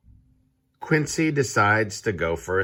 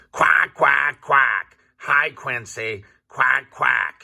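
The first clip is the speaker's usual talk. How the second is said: high, loud quacking shout from a noisy duck; 6 semitones higher, 5 dB louder, slower than usual. A narrator's reading. Dottie the duck greets Quincy in the park, loud shouting. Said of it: "shouts Dottie".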